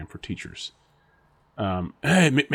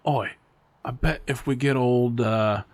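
lightly put-on Aussie voice, a bit louder; mock Australian accent; 2 semitones higher, 4 dB louder than usual. Colleagues egging on the new teacher, in a mild mock Australian accent. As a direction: play a rowdy Aussie teacher goading a mate. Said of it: "don't judge me with your look of my accent".

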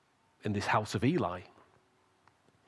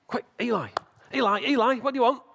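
high, loud, urgent call; eager boy calling; 11 semitones higher, 6 dB louder, faster than usual. Acted. Young Samuel running to Eli at night, eager calling. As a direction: play an excited lad waking someone.

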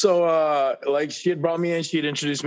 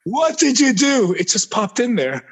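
excited; 10 semitones higher, 7 dB louder than usual. Real, not acted. Excited laughing reply.

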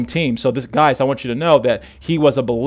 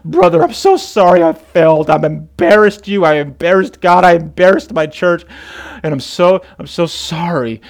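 higher, louder mock-tearful apology, no real sobs; imitated weeping; 4 semitones higher, 5 dB louder than usual. Jailed friend apologizing to his pastor, imitated weeping. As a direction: plead an apology as if crying. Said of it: "he began weeping, and he, he's crying".

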